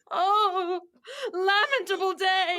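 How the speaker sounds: high loud wail of grief; loud lament. Acted. Nurse to Lady Capulet, wailing in grief. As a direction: cry the day out.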